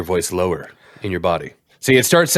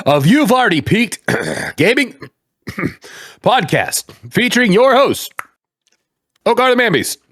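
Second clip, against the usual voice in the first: show announcer; 10 semitones higher, 3 dB louder than usual. Acted. Host announcing the podcast to listeners, high and hyped. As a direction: call it out high and big like a ring announcer.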